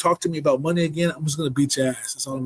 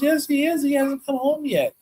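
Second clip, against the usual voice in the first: woman at the door; 10 semitones higher than usual. Retold or imitated.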